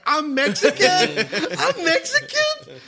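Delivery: high, wailing mock sob, half laughing; hammed-up child's tearful question; imitating a crying child. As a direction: retell a little boy wailing in disbelief. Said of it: "he started crying".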